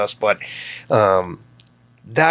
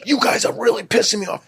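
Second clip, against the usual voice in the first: much higher, irritated mock tirade; retold angry outburst; 13 semitones higher than usual. Father storming down on noisy kids while recording, much higher irritated tirade. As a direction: replay how you'd blow up at the kids, pitched way up. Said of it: "I'd fly down there".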